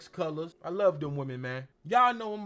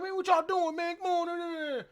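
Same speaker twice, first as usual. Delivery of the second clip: imitating Hangman Page; 10 semitones higher, 4 dB louder than usual. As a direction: play the cowboy calling out to them.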